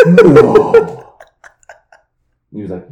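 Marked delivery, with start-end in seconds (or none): 0.1-1.1 very high, loud cry of disbelief; mock shock. Coworkers reacting to the fight, loud shocked cry. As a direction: wail it like a scandalized crowd.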